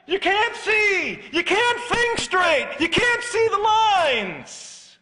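building to a high, loud, shouting pitch; preaching climax. Real, not acted. Preacher warning congregation of sudden death, rising to a shout. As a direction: drive the warning home to a roar.

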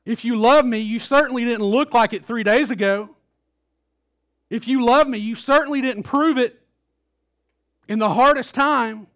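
loud, harsh, accusing rebuke; angry rebuke. Pretend. Jesus, if he had scolded Peter, in an angry rebuke. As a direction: scold him for failing in the hardest time. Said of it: "he doesn't say it in an angry voice".

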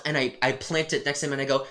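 usual speaking voice

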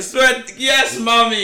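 high, loud, elated cheer; overjoyed child. Retold or imitated. Boy cheers mum for backing him, overjoyed. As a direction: act out a thrilled kid cheering. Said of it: "I was so happy".

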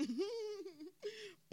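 high smug chuckle; smug laugh. Acted. Narrator as smug Karma, high smug laugh. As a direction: laugh like the winner.

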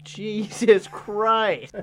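shocked. Real, not acted. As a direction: stretch it out in horror. Shocked reaction to lynch joke.

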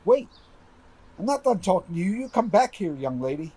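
high, urgent calling after. Acted. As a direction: shout after her to stop. Calls after fleeing girl.

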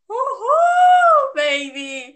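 high joyful cheer; excited whoop. Real, not acted. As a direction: shout it out in celebration. Celebrating first episode recorded. (grito de comemoração eufórico)

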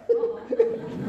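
high breathy laugh. Real, not acted. Laughing at the speeding car.

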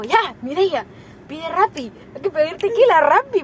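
acted excited ordering; retold urgent demand. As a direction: push for it insistently, mock-urgent. Acts out friend's urgent drinking demand.

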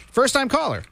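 high, hammed-up game show announcer; goofy announcer. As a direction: ring the bell like a goofy quiz host. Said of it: "me being goofy".

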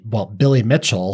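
ordinary delivery

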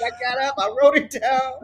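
ordinary talk

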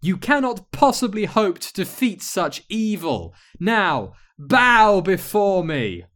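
high, loud imperious villain; hammed-up wicked witch gloating; cackling evil witch. A narrator's reading. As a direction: command surrender, stretching the last words.

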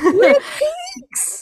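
excited. Real, not acted. Excited echo of the title.

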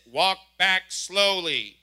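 very slow, loud officer voice; stern police order. Retold or imitated. Officer telling the driver, slow loud command. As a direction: drag it out like a cop giving orders. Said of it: "she says".

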